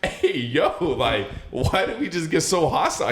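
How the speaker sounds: high-pitched, drawn-out retold disbelief, half laughing; mock startled protest. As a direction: call out to a hostile stranger, amused. Acted startled bystander questioning hostility.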